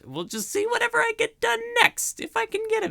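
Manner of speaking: sing-song leap into a very high voice; voice shooting high. Real, not acted. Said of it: "why my voice went that high".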